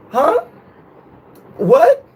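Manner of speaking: very high, exaggerated innocent query; playing dumb. Man pretending not to hear his girlfriend, playing dumb. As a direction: squeak it out as if you heard nothing. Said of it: "acting like I didn't hear".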